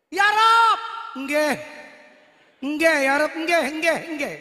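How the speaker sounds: put-on loud Javanese-accented prayer, nggih drawn. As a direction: pray loudly like a Javanese. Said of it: "Jawa, boleh".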